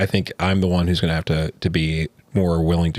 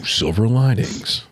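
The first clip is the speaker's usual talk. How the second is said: slow, drawn-out mock show announcer; higher, hammed-up TV segment intro; 4 semitones higher, slower than usual. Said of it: "It's like some nineties graph".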